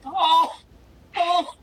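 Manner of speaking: high, loud tearful gasps; choked up. Real, not acted. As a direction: overcome with emotion, real tears. Host moved to tears.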